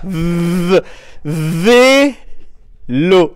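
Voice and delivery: "V-" buzzed alone, then "vélo" loud and stretched; isolated V sound. Shown as a demonstration. Teacher isolating the V in "vélo" for pupils. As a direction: buzz the V before "vélo". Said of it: "on frappe dans les mains".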